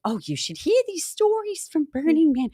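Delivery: lightly voiced Edith Bunker grandma; a hint of Edith Bunker whine. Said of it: "I'm making her sound like Edith Bunker".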